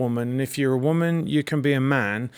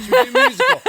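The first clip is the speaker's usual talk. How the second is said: excited; 4 dB louder, much faster than usual. Real, not acted. Excited musical idea.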